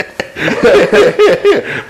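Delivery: a real high burst of laughter; a high, helpless laugh. Real, not acted. Laughing at the story.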